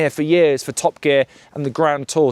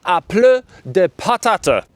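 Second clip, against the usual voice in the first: slow, exaggerated French accent; hammy schoolboy French; mock French; 5 semitones higher than usual. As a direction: say it like a Frenchman, drawn out. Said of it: "as the French would say".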